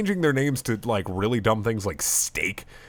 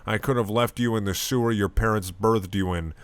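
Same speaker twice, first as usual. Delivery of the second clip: normal talking voice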